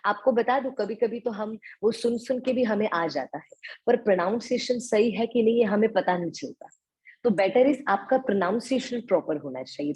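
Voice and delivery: ordinary talk, nothing marked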